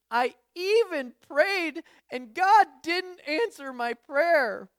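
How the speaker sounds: retelling a daughter's upset cry after the lost dog; imitating a crying child. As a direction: play the hurt little girl, half-hearted. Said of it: "Like, she's crying".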